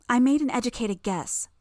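plain reading voice

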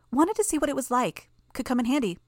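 a hint of a smug young girl; casual, breezy girl; Imp's voice. A narrator's reading. Narrator voices Imp.

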